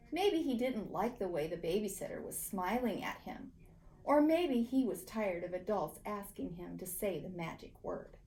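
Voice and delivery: usual speaking voice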